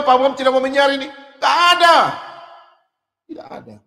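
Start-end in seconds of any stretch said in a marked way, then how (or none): 1.1-2.0 louder, higher "Tidak ada" thrown out firmly; the first "Tidak ada", pitched up and pushed out; emphatic denial. Real, not acted. Denies asking for money, repeats. 3.3-3.8 much lower and softer "Tidak ada" tossed away; the second "Tidak ada", low and soft as an afterthought; quiet echo. Real, not acted. Repeats denial as aside.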